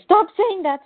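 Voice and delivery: loud, high mock yell of panic; ballistic outburst. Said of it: "And they go ballistic".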